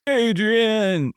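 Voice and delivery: high, drawn-out movie-style call; mock name-screaming. Guessing at a crush from Rocky, hammed-up high call. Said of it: "screaming people's names out really loud in weird voices".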